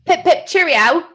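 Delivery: high, loud mock British cheerio with Aussie slipping in; a mixed British-Australian mock goodbye; British sign-off. Signing off again, hammed-up British mixed with Australian. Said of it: "I'm mixing again, Australian and British".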